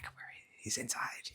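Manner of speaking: hushed, slow whisper; excited whisper. Acted. Gopis confide that Krishna is inside, secretive whisper. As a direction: whisper it like a secret between friends.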